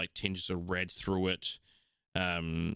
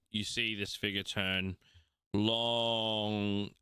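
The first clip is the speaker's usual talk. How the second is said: ordinary talk, nothing marked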